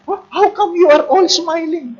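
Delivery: high-pitched incredulous question; baffled questioner. Acted. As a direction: act out an astonished foreigner. Acts baffled foreign questioner.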